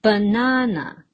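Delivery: baNAna, emphatic middle, light ending; stress on second syllable. Shown as a demonstration. Teacher repeating the answer for learners, second syllable stressed. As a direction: hit the first "na" in Banana. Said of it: "It falls on the second syllable".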